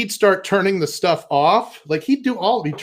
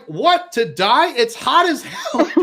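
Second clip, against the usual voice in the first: mock disbelief, higher and slow; incredulous; 6 semitones higher, slower than usual. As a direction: ask it slowly, half laughing. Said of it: "We're like".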